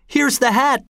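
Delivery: high excited shout; a lightly acted shout, pitched high. A narrator's reading. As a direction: call it out high to the crowd. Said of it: "shouted Ruodi".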